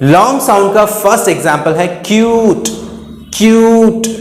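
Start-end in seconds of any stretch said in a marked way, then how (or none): 1.9-4.1 क्यूट with a lengthened oo; long vowel sound. Shown as a demonstration. Teacher demonstrates the long U, drawn-out क्यूट. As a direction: stretch the oo in क्यूट. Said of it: "ऊ की आवाज लंबी हो रही है".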